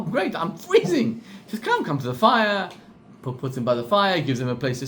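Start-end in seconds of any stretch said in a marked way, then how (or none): none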